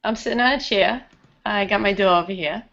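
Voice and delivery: thick Rhode Island New England accent. Shown as a demonstration. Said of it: "say it in a New England accent".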